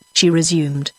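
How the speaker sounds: ordinary delivery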